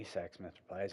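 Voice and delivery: ordinary talk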